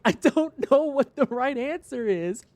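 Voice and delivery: lightly voiced defeated cashier; helpless reply. Cashier answering the sympathetic customer, slow helpless whine. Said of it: "And I was like".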